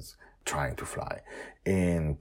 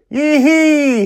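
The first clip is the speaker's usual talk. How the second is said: very high, loud joyful whoop; excited whoop; 18 dB louder than usual. Acted. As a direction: whoop with delight. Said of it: "she shouts as she zooms forward".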